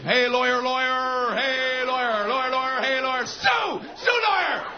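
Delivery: high droning chant, leaping up at the end; monotone holler bursting into a very high yell; mock heckling. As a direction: heckle like infield chatter. Ballpark chatter applied to a lawyer.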